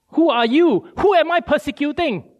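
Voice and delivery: Paul desperate for an answer from the voice; urgent repeat. Acted. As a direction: press the question urgently.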